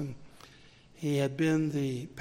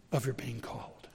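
whispered; 4 semitones lower, 7 dB quieter than usual. Real, not acted. Sermon point, unvoiced.

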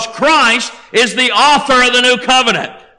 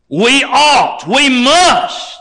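"we ought, we must" in two raised, separated beats; emphatic imperative; 3 semitones higher, much slower than usual. Real, not acted. Preacher urging the congregation to heed, emphatic. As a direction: hit "ought", then "must" harder.

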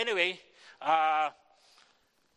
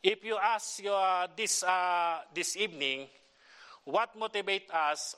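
preaching; 3 semitones higher than usual. Acted. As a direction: rouse the church with the message. Starts preaching the message.

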